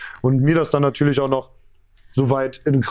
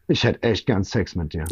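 lowered voice, confiding; imitated frank confession; 7 semitones lower than usual. Birthday girl confessing to the stripper, low and frank. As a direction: drop the pitch for a mock intimate admission.

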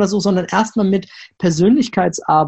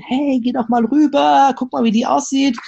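lockende innere Stimme; 6 semitones higher than usual. Retold or imitated. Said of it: "die erste Stimme sagte".

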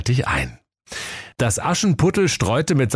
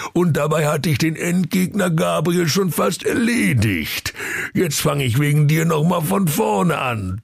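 higher, whiny gamer grumble; sulky Ruprecht; 7 semitones higher than usual. Acted. Ruprecht sulking at Santa over his game, whiny. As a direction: whine about the lost save. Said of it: "Knecht Ruprecht maulte".

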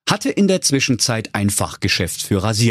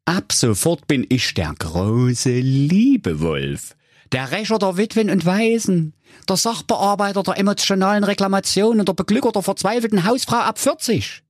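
wolf voice; 11 semitones higher than usual. A narrator's reading. Wolf's grand inner vow.

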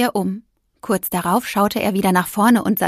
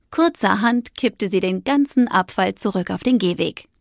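usual speaking voice

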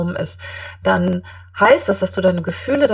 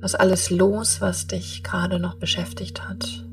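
guided meditation; 9 dB quieter than usual. Acted.

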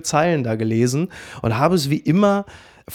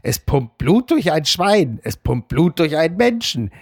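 Homer Simpson. Acted. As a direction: read the column aloud as a comic character, dragging each phrase. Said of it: "mit so einer Homer Simpson-Stimme liest". (getragen vorgelesen mit komischer Zeichentrickstimme)

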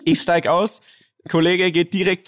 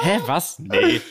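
fake surprise; much slower than usual. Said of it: "Ich so".

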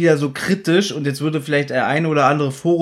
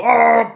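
short high yelp of a scream, louder; mock scream; 8 semitones higher, 5 dB louder than usual. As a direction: imitate a silly falling scream. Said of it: "Der Schrei von Justus ist schon ein bisschen lächerlich".

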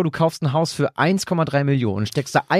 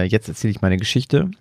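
ordinary delivery

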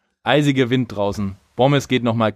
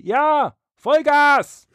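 sehr hoch und laut gebrüllt; geschrien; 3 dB louder, much slower than usual. Retold or imitated. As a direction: begeistert zurückbrüllen. Gary answers shouting.

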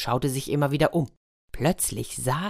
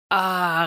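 yawn sound; 4 semitones higher than usual. Acted. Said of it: "gähnte laut".

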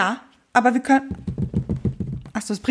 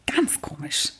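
hushed whisper; 9 semitones lower, 8 dB quieter, slower than usual. Real, not acted. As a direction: breathe it softly. Whispered aside.

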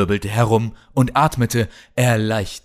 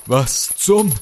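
sudden high startled gasp; 8 semitones higher, 3 dB louder than usual. A narrator's reading. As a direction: jolt awake in confusion. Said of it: "Er riss an den Ketten".

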